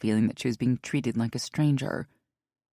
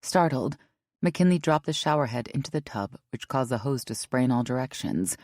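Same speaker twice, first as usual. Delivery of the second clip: ordinary delivery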